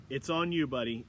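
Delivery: ordinary talk, nothing marked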